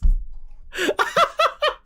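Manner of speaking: high, loud cackling laugh; real helpless laughter. Real, not acted. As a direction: crack up completely. Cracks up at his mistake.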